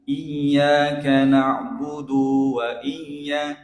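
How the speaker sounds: slow chant with 'du' of 'na'budu' dragged out; wrongly long du. Shown as a demonstration. Teacher imitating a common mistake, overlong du.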